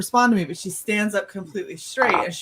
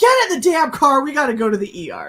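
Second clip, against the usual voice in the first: louder, higher barked order, then hurried; retold snappy command; yelling mom; 6 semitones higher, 4 dB louder, faster than usual. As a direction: imitate an irritated mother rushing a kid. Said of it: "Why are you yelling at me".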